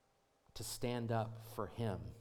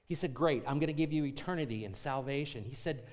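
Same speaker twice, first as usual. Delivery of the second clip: louder, higher, driving sermon climax; full-force preaching; 7 semitones higher, 10 dB louder than usual. Acted. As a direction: preach it out to the congregation with rising fire. Pastor builds into full preaching.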